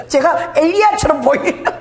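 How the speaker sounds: squeaky high laugh into a slow question; laugh, then ask amused. Real, not acted. Laughs at Elijah likeness.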